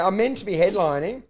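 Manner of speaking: ordinary talk, nothing marked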